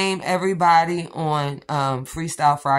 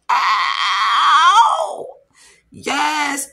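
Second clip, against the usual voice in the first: excited shout; 8 semitones higher, 7 dB louder than usual. Real, not acted. Thrilled reaction to the color.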